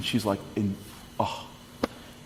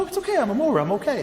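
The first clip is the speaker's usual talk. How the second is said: much higher, sweet reassurance; reassuring teacher; 12 semitones higher, faster than usual. Retold or imitated. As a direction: soothe the worried mom. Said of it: "she's like".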